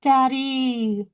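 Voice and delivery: put-on high, drawn-out kid's cry; mock child's yell. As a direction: imitate a child calling out for a falling father. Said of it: "you just hear the one kid yell".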